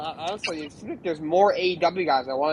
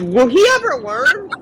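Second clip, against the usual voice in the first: higher, louder and slowed, fed-up disbelief; exasperated; 9 semitones higher, 5 dB louder, slower than usual. Real, not acted. Fan fed up with a wrestler's repeated arrests, exasperated. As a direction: drag it out like you're sick of him.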